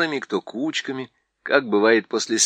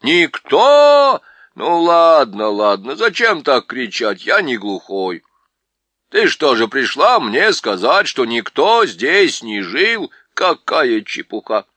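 dwarf annoyed at the shouting owl; grumpy deaf dwarf; 9 dB louder, slower than usual. A narrator's reading. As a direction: fuss like an affronted deaf dwarf.

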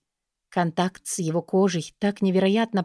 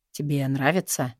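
low male voice; 4 semitones lower than usual. A narrator's reading. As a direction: ask gently and low. Lover asks tenderly.

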